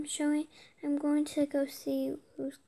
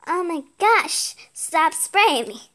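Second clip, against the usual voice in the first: sprayed with water; 8 dB louder, slower than usual. Real, not acted. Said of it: "It sprayed you with water again".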